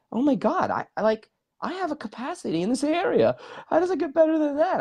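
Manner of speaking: high-pitched, excited wonder; retold amazement. Retelling delight at having a capacity, amazed. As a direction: pitch it way up, thrilled by the discovery.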